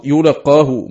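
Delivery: يلقاه said yulaqqahu; doubled qaf. Shown as a demonstration. Teacher showing Ibn Amir's reading, يلقاه with doubled qaf. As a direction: open يلقاه on yu and hold a double q. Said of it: "مشددا والياء مضمومة".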